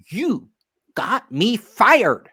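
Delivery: furious director telling the narrator he got her fired; angry accusation. Retold or imitated. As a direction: hit him with it, slow and furious. Angry boss accusing him.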